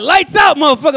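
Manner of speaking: high, loud barked order; strict father. Retold or imitated. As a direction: bark it like a prison guard dad. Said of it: "He'd be like".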